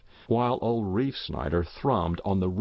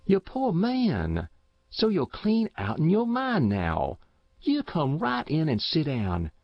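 put-on higher, coaxing old countrywoman; motherly farm wife; 5 semitones higher than usual. A narrator's reading. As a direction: soothe a confused old man. Matilda soothes the old man.

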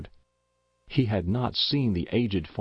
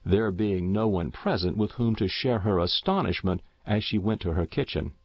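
usual speaking voice

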